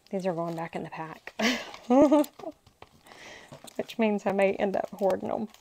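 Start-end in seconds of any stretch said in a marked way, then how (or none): 1.4-2.5 short, high-pitched burst of laughter. Real, not acted. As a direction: laugh at yourself. Laughs at own hoarding.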